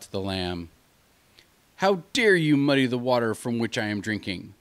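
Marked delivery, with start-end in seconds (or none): 1.8-4.5 loud, indignant wolf demand; wolf's angry accusation. Acted. Wolf accusing the lamb at the river, angry and loud. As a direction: bark it at the lamb like a bully.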